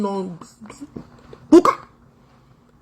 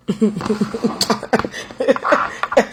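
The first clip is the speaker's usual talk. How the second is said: breathless laugh; 2 semitones lower, 4 dB quieter than usual. Real, not acted. Mocking laugh at the clip.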